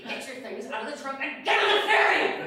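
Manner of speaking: exasperated order, half-shouted; retold angry yell. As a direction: snap at her to get out. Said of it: "I just lost it".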